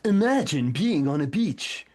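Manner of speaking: mock ad narrator inviting you to a beach. Shown as a demonstration. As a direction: sell the daydream like a commercial. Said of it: "you'll see this in advertisement".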